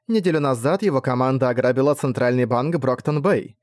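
ordinary delivery

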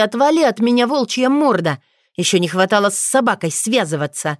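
barking. Acted. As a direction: bark him away. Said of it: "натурально гавкать на Альфу".

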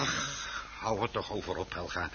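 zacht en gelaten; vermoeid afwimpelen. Acted. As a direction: zucht het eruit, laat het gaan. Man wimpelt zacht af. (man wimpelt zijn vrouw zacht en moe af)

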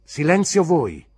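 very loud, high, slow exclamation; heated, defiant comeback; angry retort. Acted. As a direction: throw the order back at the teacher. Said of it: "esclamò Steerforth, diventando rosso".

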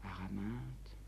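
soft murmur, almost lost; a faint murmured name. Acted. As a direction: murmur the name as you pull back.

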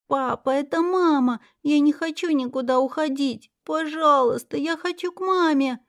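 put-on high whiny child pleading; whiny tattling little sibling. A narrator's reading. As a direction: whine like a stubborn little girl. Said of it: "Капризно захныкала она".

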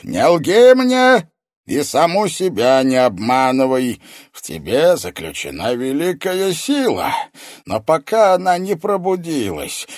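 booming, portentous evil sorcerer; commanding sorcerer. A narrator's reading. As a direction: lecture the captive with dark authority.

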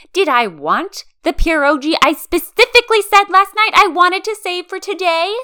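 high loud indignant outburst; outraged. A narrator's reading. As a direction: let the fury explode. Angry outburst over pierogi.

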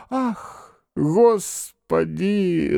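a drawn-out soft exclamation; flustered old man. A narrator's reading. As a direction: get flustered and overwhelmed. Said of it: "заволновался старик".

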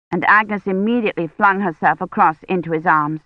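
plain reading voice

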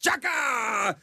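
hoog uitgeroepen kreet; strijdkreet. Acted. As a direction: call it out as a high-pitched rallying cry. Roept de strijdkreet uit. (hoge strijdkreet)

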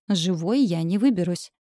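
ordinary delivery, nothing marked

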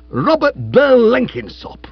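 high, loud, drawn-out name; erupting outrage. A narrator's reading. Wife repeating the knighted rival's name, erupting. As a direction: stretch the name into a loud wail. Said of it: "She continued to erupt".

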